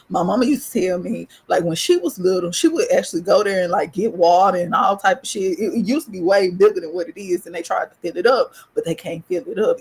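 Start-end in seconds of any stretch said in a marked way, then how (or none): none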